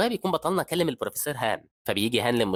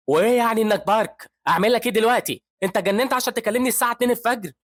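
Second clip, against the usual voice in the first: high, loud irritated snap at a junior; a furious senior woken at 2 a.m; annoyed professor; 11 semitones higher, 6 dB louder than usual. A narrator's reading. Professor scolding the junior doctor on the phone, high and loud. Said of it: "بيزعل البروفيسور هان وبيقول".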